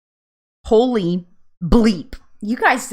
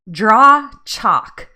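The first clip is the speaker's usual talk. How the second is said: Southern accent; 2 semitones higher, 9 dB louder than usual. Shown as a demonstration. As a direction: DRAW CHALK loud, with open Southern vowels. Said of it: "how they say it. Very Southern accent".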